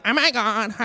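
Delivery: mock airy valley-girl Oh my God; weird girl voice. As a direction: imitate the weird voice lightly. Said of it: "they start to have this weird voice".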